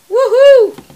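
high loud cheer; excited whoop. Real, not acted. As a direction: whoop for joy.

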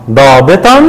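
ضابطًا read as "daabitan"; fatha tanween. Shown as a demonstration. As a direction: end ضابطًا on "-tan". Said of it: "فعشان كده نطقنا".

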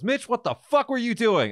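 imitating a boss yelling, high-pitched; mock outburst from the boss at work; angry boss. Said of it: "he goes".

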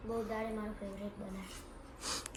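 barely audible mumble over food; soft fast mumble before being told to speak up; mumbled softly. Real, not acted. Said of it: "જોરથી બોલ".

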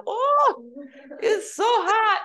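high, drawn-out grandmotherly complaint; imitating grandma's slow, high-pitched moan; Italian grandmother. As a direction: wail about the heat like an Italian grandmother, half laughing. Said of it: "starting to sound like my Italian grandmother".

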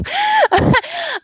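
high-pitched giggle; embarrassed laugh. Real, not acted. Teacher admitting she can't do accents, high laugh.

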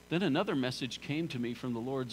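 ordinary talk

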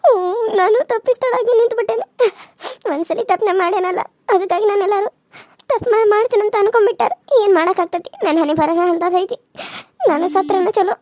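tearful, despairing lament; acted crying. Lamenting her fate to Nitya after being doubted, crying. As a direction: sob out a bitter self-pity. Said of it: "ನೀನ್ ಇಷ್ಟ ಅಳಾಕತ್ತಿದ್ದಿ".

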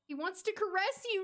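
normal talking voice

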